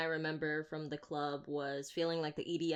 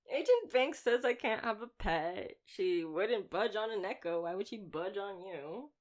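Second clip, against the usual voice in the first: higher, louder mock-scolding tease; playful teasing; 4 semitones higher, 3 dB louder than usual. Slayer teasing the vampire that Banks forbids pets, playful. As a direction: needle him like it's all a joke. Teasing captive about being pet.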